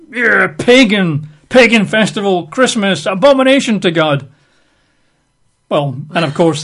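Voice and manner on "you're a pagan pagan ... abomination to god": mock zealot, higher and louder; self-righteous condemnation. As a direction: denounce a stranger from your high horse. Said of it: "tell them that".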